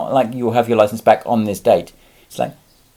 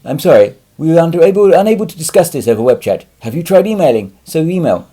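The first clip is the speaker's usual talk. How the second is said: louder, higher mock customer service reply; mock automated message; 6 semitones higher, 9 dB louder than usual. Mocking the canned reply he gets from the DVLA, in a louder, higher voice. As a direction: parrot the canned web chat brush-off. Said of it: "get a message saying".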